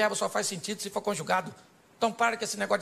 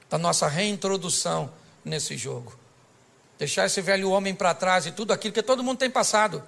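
ordinary delivery

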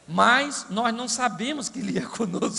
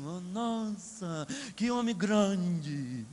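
mock awed giant; 3 semitones lower, 5 dB quieter, much slower than usual.

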